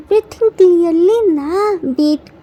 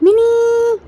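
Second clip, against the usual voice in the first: raised call for help; shout; 5 semitones higher than usual. A narrator's reading. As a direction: call across the house. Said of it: "Jin shouts".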